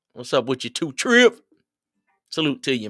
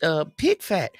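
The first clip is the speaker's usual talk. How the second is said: put-on elderly lady; 9 semitones higher than usual. Old lady answering about greens.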